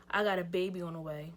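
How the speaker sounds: put-on low, quiet confession in a boy's voice; quoted boyfriend. Storyteller quoting boyfriend's baby confession, low and understated. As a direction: drop low and soft to quote him.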